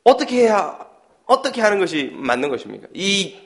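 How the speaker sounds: ordinary talk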